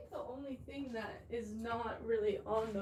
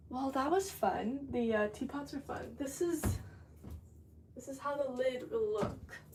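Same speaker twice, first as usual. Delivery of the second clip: ordinary talk, nothing marked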